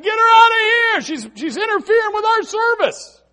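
very high, strained shout; mock indignant yell. Disciples complain about the crying mother, mock indignant yell. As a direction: holler it as annoyed disciples. Disciples want the woman removed.

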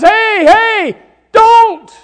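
high, loud pleading shout; grandchild's cry. Acted. Grandchild shouting to stop a grandparent, high and loud. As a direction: stop them with a child's yell. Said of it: "a grandchild says".

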